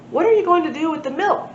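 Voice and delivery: brightly voiced dinosaur question; dinosaurs asking. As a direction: lift the voice up as a chirpy dinosaur.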